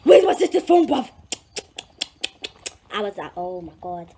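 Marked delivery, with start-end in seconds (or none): 0.0-1.1 hammed-up demand from friends; imitated angry demand. Brother demanding the phone. 3.4-4.1 lower, much softer; hushed dismay. Retold or imitated. Quiet horror at her mistake.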